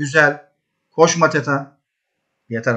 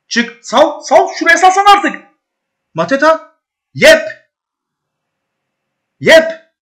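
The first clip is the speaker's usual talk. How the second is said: climbing higher and louder with each word; rising excitement; 12 semitones higher, 6 dB louder, slower than usual. Real, not acted. Manager watching the attack unfold, urging then cheering, higher and louder. Player breaking through, goal.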